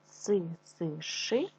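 Си-си-ши drawn out very slowly, syllables apart; Си-си-ши spoken slowly for children to copy; slow syllable drill. Shown as a demonstration. Teacher drills kids on a tongue twister, slow syllables. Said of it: "я шкарпеточки сушу".

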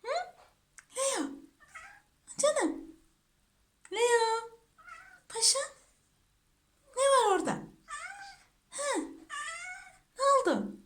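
high cooing voice. Real, not acted. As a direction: coo questions at a beloved pet. Cooing to whining pet. (tiz, bebekle konuşur gibi)

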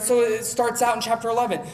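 ordinary delivery

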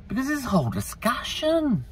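high-pitched Scottish lilt; suddenly Scottish-sounding; accidental Scottish accent. Real, not acted. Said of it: "I don't know why I suddenly sound Scottish".

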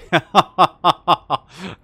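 loud burst of real laughter; hearty laugh. Real, not acted. Gamer cracking up at his own nickname, loud laughter.

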